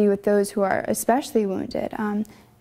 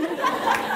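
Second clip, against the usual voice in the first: airy giggle, almost silent; breathless laugh; 7 semitones higher, 3 dB quieter than usual. Real, not acted. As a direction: let out a soft breathy laugh. Laughing after joyful scream.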